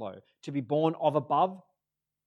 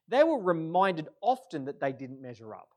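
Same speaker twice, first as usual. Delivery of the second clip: ordinary talk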